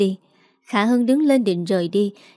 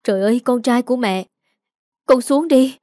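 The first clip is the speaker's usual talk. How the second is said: terrified mother crying out, higher and louder; frightened cry; 3 semitones higher, 4 dB louder than usual. Acted. Mother cries out in fear to her son on the rooftop. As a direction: shout up to him in panic, pleading. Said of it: "sợ hãi hét lên".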